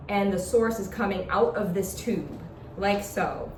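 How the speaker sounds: ordinary talk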